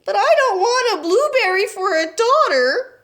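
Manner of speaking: high, loud protest; a mother refusing her fate; yelled. A narrator's reading. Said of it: "yelled Missus Beauregard".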